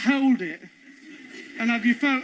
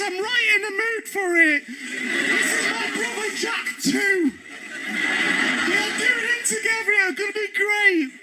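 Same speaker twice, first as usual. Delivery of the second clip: higher, slowed mock-gleeful voices; mock excited tourists; 8 semitones higher, slower than usual. Tourists gleefully announce themselves as Jack the Ripper in a high mock voice. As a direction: ham up cheerful Ripper fans. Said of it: "People were walking around going".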